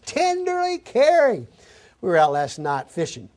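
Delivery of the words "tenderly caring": high, slow, tender mother voice; tenderly caring. Shown as a demonstration. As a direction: coo it like a nursing mother. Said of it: "you know what that means".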